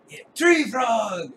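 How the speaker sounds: big drawn-out announcer shout; arena hype call; fight commentator. Acted. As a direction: bellow the fighter's name, high and slow. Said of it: "the commentator walks over to you".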